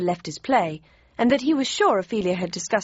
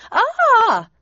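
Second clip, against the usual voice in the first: much higher, loud startled yelp; sudden shout; 8 dB louder than usual. Acted. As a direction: blurt a sharp cry of alarm. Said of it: "I shouted out quite spontaneously".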